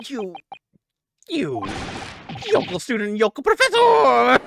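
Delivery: sputtering, building to a roar; outraged yell, slowing on the last words; furious shout. Acted. Prosecutor sputters in rage.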